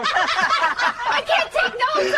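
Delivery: slow words squeezed out through helpless laughter; can't get the words out for laughing; laughing too hard. Real, not acted. Doctor trying to take notes, cracking up laughing. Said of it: "I'm laughing too hard".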